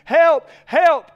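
loud, high shouts of help; man stuck in a hole, shouting up for help; yelling for help. Acted. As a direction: cry out from the bottom of the hole. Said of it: "he begins to yell again".

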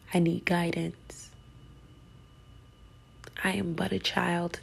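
soft, unvoiced plea; whispered prayer. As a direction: pray it barely aloud, humbly.

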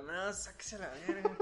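put-on a slow, laughing drunk telling the girl to back off; drunk refusal. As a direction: push her away, half laughing. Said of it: "Y yo".

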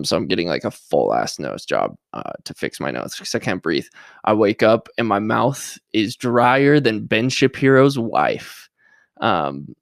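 ordinary talk

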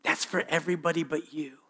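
soft, sly voice of the liar; mock whisper, sly and low; a hint of the devil murmuring a lie; Satan whispering. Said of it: "Satan's whispering in your ear".

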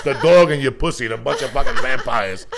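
loud giggling riff; laughing rant. Real, not acted. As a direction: rant while cracking up. Laughing rant about apocalypse.

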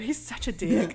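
ordinary talk, nothing marked

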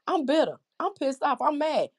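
mild imitation of a bitter man railing at God; a hint of angry frustration; voicing a bitter man. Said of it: "This man is saying".

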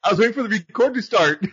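real giggles breaking through the words; giggling. Real, not acted. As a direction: talk while fighting back the giggles. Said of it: "I gotta get these giggles out now".

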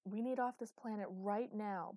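loud, slow-stressed urgent interruption; breaking in. Acted. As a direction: cut in insistently, leaning on each word. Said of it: "broke in Luke".

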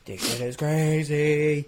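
very slow, drawn-out amazement; awed TV viewer. Acted. Viewer reacting to a cool tackle, drawn-out awe. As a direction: stretch out "crazy" in mock awe. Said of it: "a cool tackle and you're like".

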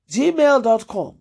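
ordinary talk

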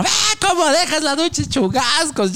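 high mock scolding mom; high voiced scolding mother. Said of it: "venía mi mamá".

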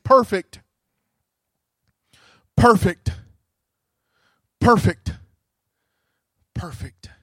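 angels' chant sinking to reverence. Acted. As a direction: space out each perfect, then drop away. Slow repeated words, fading.

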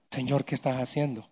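very low, hushed plea; understated broken question, almost to himself; retold tearful prayer. Preacher retelling his crying to God at the window, hushed tearful prayer. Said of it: "comencé a llorar y decirle".

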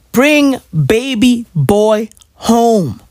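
word by word, drawn out; slow insistent plea. Real, not acted. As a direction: spell it out slowly, pausing after each word.

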